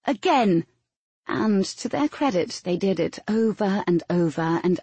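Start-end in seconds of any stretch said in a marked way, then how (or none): 0.1-0.7 firm raised call, falling sharply; holler. A narrator's reading. Coach ordering recruits to repeat the drill, hollering. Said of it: "would holler".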